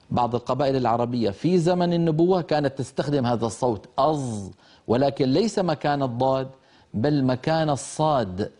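ordinary talk, nothing marked

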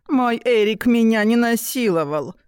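high squeaky mocking mimicry; писклявое передразнивание. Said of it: "передразнил писклявым голосом". (писклявым голосом, передразнивая)

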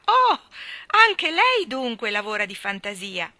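high, loud cry of delight; Oh shot up high and loud with joy; joyful exclamation. Acted. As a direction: burst out thrilled to find a kindred spirit. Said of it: "esclamò piena di felicità".

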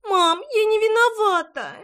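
put-on high, loud childish wail; whining child. A narrator's reading. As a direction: whine in protest like a little girl. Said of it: "Най захныкала".

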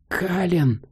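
high call from a distance. A narrator's reading. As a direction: call his name, leading him on. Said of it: "раздался голос девушки". (зов издалека)

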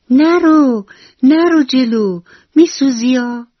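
high, loud alarmed cry; shouted warning. Acted. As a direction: shout to stop the butterfly. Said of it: "موشی داد زد".

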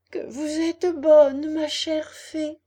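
high, loud, drawn-out thanks; tearful gratitude. A narrator's reading. Puppet thanking his kind fairy after crying, gushing gratitude. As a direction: pour out gratitude, slowly and high. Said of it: "en s'essuyant les yeux".

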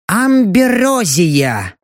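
slow, awed elf voice; reverent elf. Acted. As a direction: breathe the word out in awe. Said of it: "с благоговением выдохнул".

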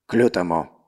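eerie warped distant voice; distorted voice. Acted. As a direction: voice bent and warped. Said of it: "услышал он чей-то сильно искажённый голос".

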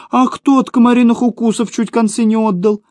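high-pitched indignant retort; indignant near-cry. A narrator's reading. Seva nearly shouts about the mosquito bites, indignant. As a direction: protest high, just short of a shout. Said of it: "чуть было не закричал Сева".

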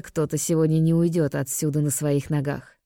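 usual speaking voice

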